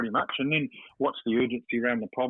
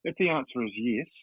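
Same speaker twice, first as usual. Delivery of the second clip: ordinary talk, nothing marked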